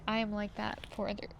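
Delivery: ordinary talk, nothing marked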